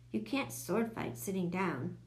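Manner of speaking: ordinary delivery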